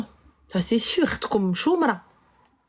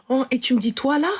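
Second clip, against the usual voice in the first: ordinary talk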